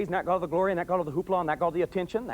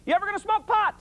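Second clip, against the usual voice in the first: much higher barked question; drill sergeant yell. Retold or imitated. Father yelling at daughters lined up on the couch. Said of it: "I'd turn and I'd yell".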